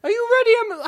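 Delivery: high-pitched try at a character voice. Acted. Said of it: "How does [name] talk?".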